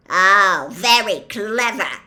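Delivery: loud, sarcastic growl; gruff, angry sarcasm. A narrator's reading. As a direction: growl it with heavy scorn. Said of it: "growled Hagrid".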